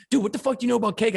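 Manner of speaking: high, fast mock yell at a friend. Co-host retelling how his partner yelled at him, a fast mock yell. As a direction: fire the question off like a fed-up yell. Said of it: "you'd always yell at me".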